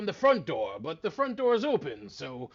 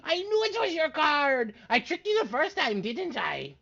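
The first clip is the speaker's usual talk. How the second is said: triumphant wizard; 13 semitones higher, 8 dB louder than usual. Acted.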